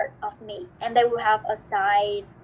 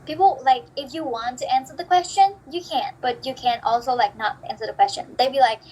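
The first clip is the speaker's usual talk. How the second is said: usual speaking voice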